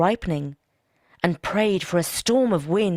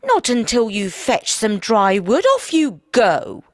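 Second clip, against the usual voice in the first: stern wife; 3 semitones higher, 7 dB louder, slower than usual. Acted. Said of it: "his wife said".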